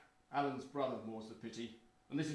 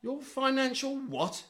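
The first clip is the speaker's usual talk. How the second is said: high, slow, incredulous query; mocking astonishment at a brother's claim; scornful disbelief; 11 semitones higher, slower than usual. Disbelieves brother has an advisor.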